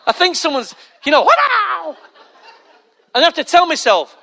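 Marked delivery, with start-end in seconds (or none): 1.2-2.0 high, loud startled cry; startled yell. Acted. Jumping at someone behind, acted startled yell. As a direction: yelp out long and high.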